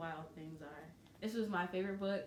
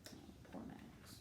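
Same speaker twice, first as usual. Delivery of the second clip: pitying whisper; 14 dB quieter than usual. Real, not acted. Reader aside, pitying.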